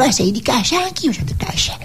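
a surprised, conspiratorial discovery; mock first meeting. Comedian voicing two people meeting, one whispering. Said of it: "La prima volta può essere successo".

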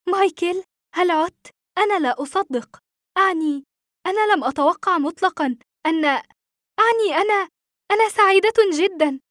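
astonished, emotional mother; mother crying out. A narrator's reading. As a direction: stammer with disbelief and joy. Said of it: "وصاحت أمي".

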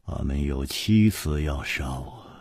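low, soft, deliberate reply; thoughtful, subdued and low; pondering, unhurried. A narrator's reading. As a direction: weigh it quietly before committing. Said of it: "沉吟着， 缓缓地说".

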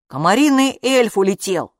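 usual speaking voice

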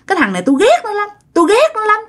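high, fast hateful outburst; spiteful outburst. Retold or imitated. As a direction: spit out the hatred, rushing and high. Ghost rages at the husband. (giọng oán hận, cao và nhanh)

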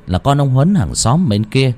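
ordinary delivery, nothing marked

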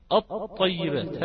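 slow "الطيبات", holding the doubled ط with no lam; assimilated lam. Shown as a demonstration. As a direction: demonstrate "الطيبات" slowly, leaning on the doubled ط. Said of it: "هكذا".

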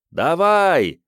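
громко, выше, задорно; крикни с места, подбадривая; громкий выкрик. Acted. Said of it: "закричали зрители". (громкий крик зрителей)

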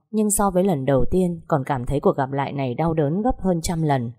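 ordinary talk, nothing marked

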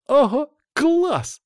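put-on very high, drawn-out girls' cheer; gleeful high-pitched wow; delighted. A narrator's reading. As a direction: squeal with delight, slowly stretched. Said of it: "обрадовались девочки".

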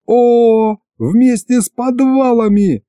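high, drawn-out eureka; jumping at a sudden idea; excited outburst. A narrator's reading. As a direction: seize on the idea with delight. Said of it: "Я подпрыгнул на месте".

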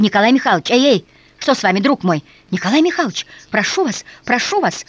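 the general begs the dying man to answer, in rising alarm; panicked calling. A narrator's reading. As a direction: try to rouse him, calling out. General calls the fainting man.

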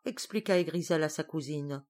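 ordinary delivery

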